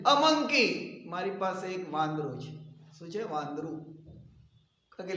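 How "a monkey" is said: high, loud announcement; speaking up loudly. Acted. Pia finally tells the class, loud and decided. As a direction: blurt it out at raised pitch and volume. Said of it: "એક ઊંડો શ્વાસ લે છે અને જોરથી".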